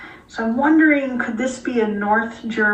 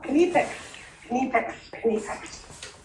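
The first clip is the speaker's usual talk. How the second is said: imitating German Kniepex; 3 semitones higher, 5 dB quieter, slower than usual.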